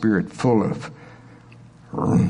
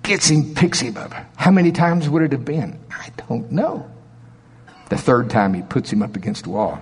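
ordinary delivery

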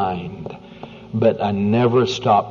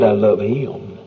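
declared aloud; slower than usual. Shown as a demonstration. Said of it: "talk loud enough for him to hear".

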